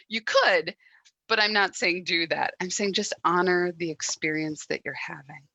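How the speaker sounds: ordinary delivery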